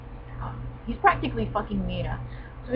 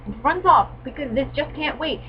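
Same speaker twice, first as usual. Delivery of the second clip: usual speaking voice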